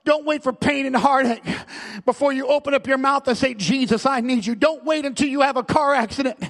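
urgent, driving sermon delivery; full-force preaching. Real, not acted. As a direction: plead hard with the congregation.

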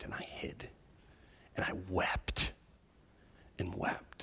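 soft breathy whisper, almost gone; whispered weeping. Retold or imitated. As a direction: let the words fade into tears. Retelling friend weeping.

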